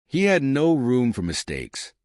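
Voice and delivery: ordinary delivery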